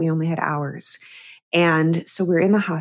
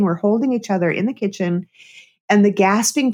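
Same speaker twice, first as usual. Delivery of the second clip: ordinary talk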